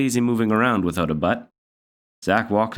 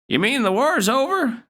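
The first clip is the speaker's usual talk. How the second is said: put-on high old grandmother voice.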